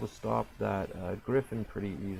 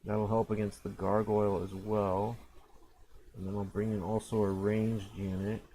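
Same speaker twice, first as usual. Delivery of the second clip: ordinary talk, nothing marked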